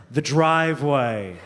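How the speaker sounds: slow, drawn-out mock regret; dawning realization. Comic as pothead back from the adventure, slow realization. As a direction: let it sink in slowly.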